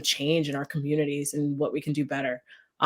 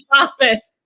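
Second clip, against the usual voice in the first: laughing; 9 semitones higher, 10 dB louder than usual. Real, not acted. Guest jokes about her age.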